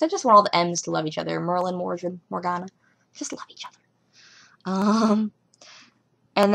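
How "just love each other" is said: soft unvoiced whisper; hushed pleading whisper; whispered plea. Real, not acted. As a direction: breathe it like a secret wish. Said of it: "whispered plea".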